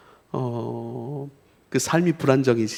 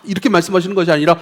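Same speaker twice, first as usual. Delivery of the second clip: ordinary talk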